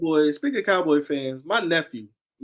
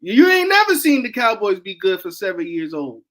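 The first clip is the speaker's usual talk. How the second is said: ordinary talk, nothing marked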